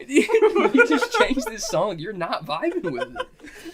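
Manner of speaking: loud, high, drawn-out plea through laughter; exasperated pleading. Retold or imitated. Driver begging drunk friend to change the sad song, exasperated. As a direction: beg in laughing exasperation, dragging the words.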